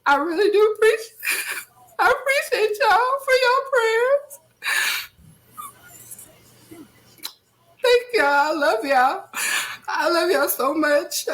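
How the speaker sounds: real sobbing, high-pitched and breaking into tears; crying. Real, not acted. Host thanking viewers for prayers after losing a sister, crying. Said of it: "not gonna cry on here".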